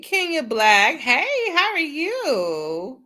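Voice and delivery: high, slow, sing-song hello; laughing, stretched-out welcome; excited greeting. Real, not acted. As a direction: greet a friend with delight. Greeting a viewer warmly.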